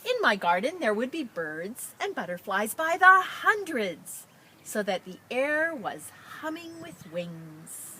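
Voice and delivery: expressive picture-book read-aloud; reading to children. Acted. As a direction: read it out brightly to a class. Teacher reads picture book aloud.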